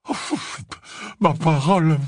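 puffed-out gasps around the words; panting. A narrator's reading. Slughorn reacting to the professor, panting in alarm. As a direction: speak through huffing breaths. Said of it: "haleta-t-il".

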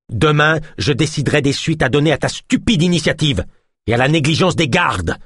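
high, seething threat; growling anger. A narrator's reading. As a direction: scold him with menace. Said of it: "gronda le guérisseur".

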